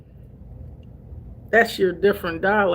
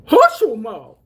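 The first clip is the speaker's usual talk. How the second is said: country folks talk; 2 semitones higher, 6 dB louder than usual. Retold or imitated. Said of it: "I love to hear country folks say".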